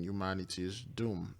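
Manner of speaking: ordinary talk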